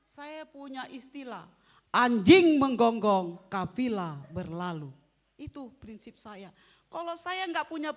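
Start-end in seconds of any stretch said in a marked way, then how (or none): 1.9-4.9 loud, slow proverb proclamation; proclaimed proverb. Real, not acted. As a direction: boom out the saying slowly. Preacher declaiming proverb. (peribahasa diserukan lantang dan pelan)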